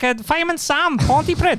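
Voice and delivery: very high-pitched Pontypridd lilt; Welsh accent. Shown as a demonstration. Explaining the accent to co-hosts, high mock Welsh. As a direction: show off a Fireman Sam Welsh accent, way up high. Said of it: "I was trying to do, like".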